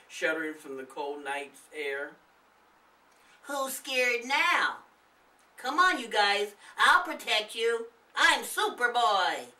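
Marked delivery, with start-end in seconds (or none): 3.4-9.5 put-on higher, louder brave-boy bravado; boastful boy. A narrator's reading. Nathan teasing his scared friends, boastful. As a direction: puff up like a little hero. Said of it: "Nathan smiled".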